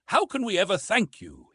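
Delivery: high, eager outburst of thanks; exclaiming. A narrator's reading. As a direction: burst out gratefully. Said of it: "he exclaimed".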